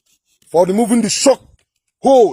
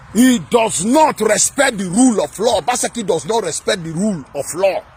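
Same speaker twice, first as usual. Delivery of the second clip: ordinary delivery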